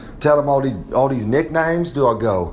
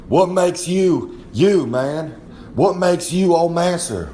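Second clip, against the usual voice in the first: slow, higher, wide-eyed rookie asking; slow and higher, in awe of the master; awed rookie question; 5 semitones higher, slower than usual. Retold or imitated. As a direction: drag it out like a starstruck kid. Rookie asks wrestler big question.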